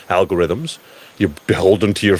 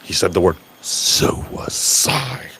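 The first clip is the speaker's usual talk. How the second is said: mock-horrified whisper; slow whispered gasp of outrage; scandalised whisper; much slower than usual. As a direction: hush it like gossip about a scandal. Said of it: "Oh my God".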